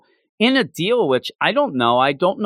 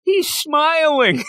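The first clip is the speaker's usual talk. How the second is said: ordinary talk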